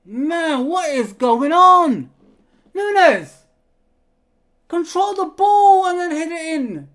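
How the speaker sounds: loud, high, drawn-out yell of frustration; long, loud, exasperated cries; exasperated fan shouting. Real, not acted. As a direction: yell at the striker in disbelief. Fan yells at missed chance.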